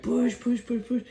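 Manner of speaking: very high, loud push chant; dad cheering the baby, very high and loud; frantic cheering. Retold or imitated. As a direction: squeal her on. Still coaching.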